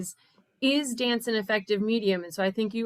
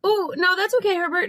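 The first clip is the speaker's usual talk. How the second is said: raised voice over a loud alarm; shouting over fire alarm; 10 semitones higher, 6 dB louder, faster than usual. Real, not acted. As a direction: call out over the alarm noise. Smoke alarm going off nearby.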